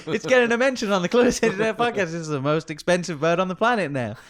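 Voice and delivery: fast, high, loud protest; mock indignant. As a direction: oversell the bird's fame. Mock indignant defence of parrot.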